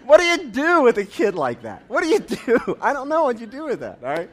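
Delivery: can't get the words out for laughing. Real, not acted. Laughing at the punchline.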